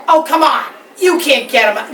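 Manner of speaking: loud, dismissive protest; scoffing. Acted. Big animal doubts the little bee, scoffing. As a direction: brush the little one off loudly.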